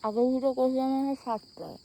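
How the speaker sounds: talking through a toothbrush; while brushing teeth. Real, not acted. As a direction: mouth full of toothpaste. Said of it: "এখন brush করছি". (দাঁত brush করতে করতে)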